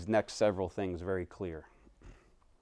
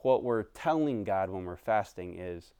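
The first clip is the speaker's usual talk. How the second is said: ordinary talk, nothing marked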